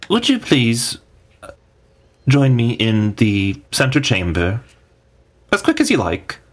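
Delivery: a light magical messenger; telepathic summons. Acted. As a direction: voice in the head summoning the party. GM voices telepathic message.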